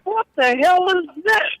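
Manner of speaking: high, slow, baffled exclaim; mock astonishment at two gear sticks; retold disbelief. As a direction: act floored by the strange truck. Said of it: "I'm like".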